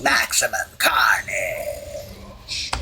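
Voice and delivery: drawn-out mock shout of an attack name; imitated battle yell. Gamer imitating a Carnage character's ultimate attack, mock yell. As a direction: stretch it out slowly like a villain's battle cry. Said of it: "You can tell because he yells".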